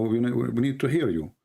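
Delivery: ordinary delivery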